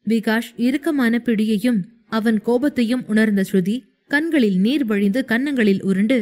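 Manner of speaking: plain reading voice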